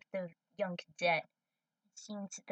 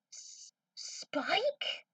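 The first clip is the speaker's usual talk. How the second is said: stunned whisper; 10 semitones higher than usual. Acted. Sees Spike alive.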